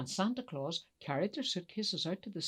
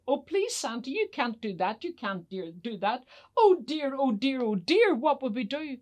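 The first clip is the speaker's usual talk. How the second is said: higher, louder worried pleading; pleading elf; 7 semitones higher, 6 dB louder than usual. Acted. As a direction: beg Santa in a rising fluster. Chief elf begs Santa to stay.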